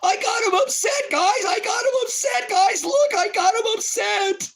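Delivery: high, loud, sing-song taunting; gloating troll voice. Pretend. Telling viewers he got David upset, a high loud gloating taunt. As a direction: crow over the win in a shrill taunt. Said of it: "Now I'm gonna troll".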